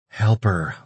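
usual speaking voice

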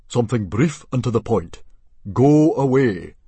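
booming chief's order; commanding chief. A narrator's reading. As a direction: instruct firmly, then bellow the last words. Plays Stoic ordering the yell.

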